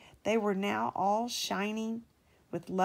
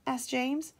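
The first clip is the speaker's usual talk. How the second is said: ordinary delivery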